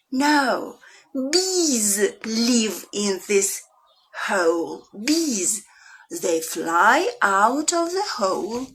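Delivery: lively storytime reading, full of questions; reading to children. Acted. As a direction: pull the kids into the story with big swings.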